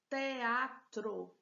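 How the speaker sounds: TEATRO said loudly in three clear syllables. Shown as a demonstration. Teacher announcing the next word to students, teatro in clear syllables. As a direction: sound out teatro as te-a-tro. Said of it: "três sílabas".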